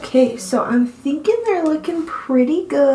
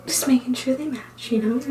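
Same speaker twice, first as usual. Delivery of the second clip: whispering; 6 dB quieter than usual. Real, not acted. Whispering while applying liner.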